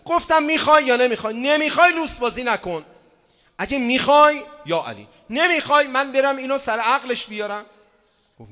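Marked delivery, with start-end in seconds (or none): 0.4-7.7 retold shouted ultimatum.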